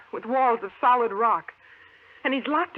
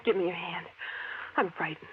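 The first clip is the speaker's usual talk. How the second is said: breathy whispered plea for comfort; frightened whisper; 5 semitones lower, 5 dB quieter, faster than usual. Acted. Woman whispers to her husband, frightened. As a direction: whisper it, scared.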